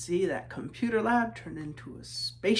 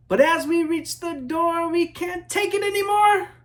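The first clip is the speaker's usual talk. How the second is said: rising higher and higher with excitement; excited build; 11 semitones higher, 10 dB louder than usual. A narrator's reading. Children reaching the playground door, excited rising build. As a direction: burst out like kids who can't hold still.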